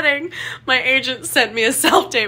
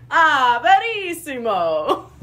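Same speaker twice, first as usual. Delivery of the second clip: Italian accent. Shown as a demonstration. Practising for an audition, emphatic Italian accent. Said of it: "help me with an Italian accent".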